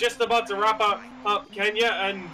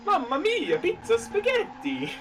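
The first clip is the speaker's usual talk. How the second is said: high, drawn-out Italian chef voice; jolly italian pizza chef; 13 semitones higher, slower than usual. Pretend. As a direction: ham up a jolly Italian cook. Joking about Italy, pizza chef.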